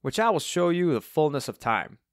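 put-on chirpy old Cockney character; cheery Cockney twang. A narrator's reading. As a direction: show off proudly in a Cockney voice. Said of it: "said Alvin in his cheery Cockney twang".

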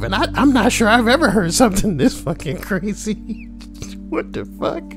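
normal talking voice

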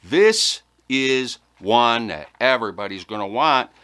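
usual speaking voice

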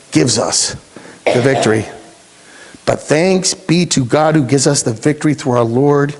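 normal talking voice

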